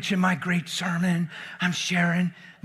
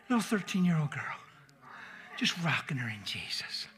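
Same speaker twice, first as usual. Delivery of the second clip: hushed tenderness; 2 semitones lower, 6 dB quieter than usual. Real, not acted. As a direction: breathe it like a lullaby. Tender cradling memory.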